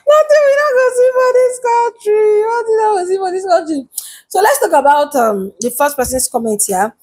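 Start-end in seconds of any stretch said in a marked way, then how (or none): 0.1-3.9 high, sustained wail of disbelief; amused wail. Real, not acted. Vlogger lamenting what this country shows her, high amused wail. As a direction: cry it out high, falling away at the end.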